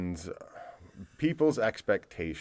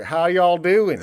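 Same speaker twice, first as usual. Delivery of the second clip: loud, higher southern greeting; southern accent; 8 semitones higher, 9 dB louder, much slower than usual. Retold or imitated. Host imitating locals he runs into, in a southern accent. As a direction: mimic a friendly southern drawl. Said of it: "people there have a southern accent".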